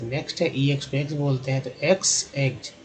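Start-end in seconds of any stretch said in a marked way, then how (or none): none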